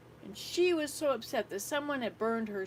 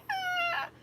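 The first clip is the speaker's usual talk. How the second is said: put-on high wailing scream of a crying child; imitated child scream; 4 dB louder than usual. Grandmother imitating a screaming child at the campfire, high wail. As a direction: mimic the child's tantrum wail. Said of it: "crying and screaming".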